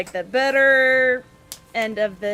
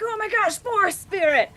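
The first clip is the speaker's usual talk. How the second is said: put-on high-pitched excited kid cry; high, awestruck exclamation; amazed children; 9 semitones higher than usual. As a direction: voice thrilled kids spotting something magical. Amazed children voiced in the story.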